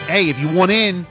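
ordinary talk, nothing marked

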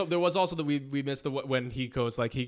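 usual speaking voice

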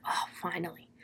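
a relieved whisper under the breath. Retold or imitated. Lori finding the key in the chest, whispering with relief.

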